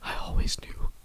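breathy whisper; whispered goat line. Pretend. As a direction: whisper it knowingly. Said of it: "the goat will then be like".

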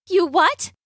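high, rising disbelief; Rapunzel's high voice, taken aback; startled question. A narrator's reading. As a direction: ask it in sudden alarm. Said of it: "asked Rapunzel".

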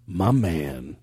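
low, laid-back cool guy; lightly voiced cool dude; cool guy greeting. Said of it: "and he goes".

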